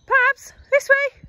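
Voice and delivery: high loud sing-song dog call. Real, not acted. Calling the dog on walk.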